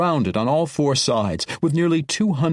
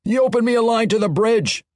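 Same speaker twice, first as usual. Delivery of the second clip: a higher dwarf call to the wall above; 9 semitones higher, 3 dB louder than usual. Acted. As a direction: call up to the batteries. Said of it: "called up to the nearby batteries".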